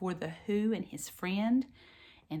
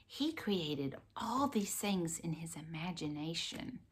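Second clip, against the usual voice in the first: whispering; 2 semitones lower, 7 dB quieter than usual. Real, not acted. Soft aside to child.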